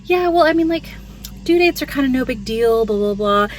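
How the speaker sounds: high, airy brush-off of the nurse; mock-dismissive nurse, high and breezy. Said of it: "she's like".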